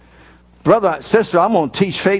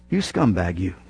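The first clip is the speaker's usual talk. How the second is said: low, soft mock sneer at himself; mock self-insult; 12 semitones lower, 6 dB quieter than usual. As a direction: growl it down like a beaten man. How the sermon made him feel.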